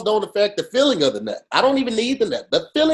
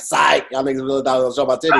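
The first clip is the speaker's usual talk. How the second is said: mocking taunt; 5 semitones lower, faster than usual. Host mimics [name] taunting her fans, fast and cocky. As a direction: gloat at the fans, quick and cocky. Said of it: "she was like".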